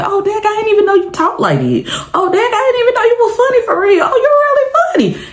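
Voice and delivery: high surprised classmate voice; mocking classmates. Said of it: "other people say".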